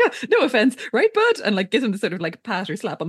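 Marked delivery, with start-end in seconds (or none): 0.0-1.4 high, louder, over-friendly imitation; hapless Winston. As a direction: play a nervous pal trying to placate. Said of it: "Poor Winston, he's like".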